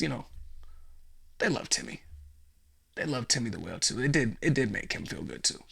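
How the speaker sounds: ordinary talk, nothing marked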